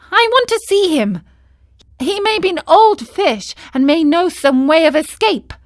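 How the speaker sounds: high, loud and fast; hopeful, eager to go; high, fast, eager puppet. A narrator's reading. Pinocchio voice.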